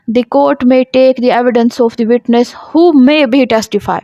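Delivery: normal talking voice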